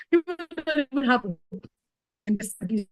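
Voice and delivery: said through a laugh. Real, not acted. Trainer amused at the state of service, laughing. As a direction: laugh while repeating the point. Laughs while repeating own remark.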